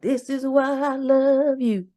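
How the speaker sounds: slow, tender "I love you"; sincere, drawn-out loving line; tender declaration. Shown as a demonstration. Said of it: "all the things like".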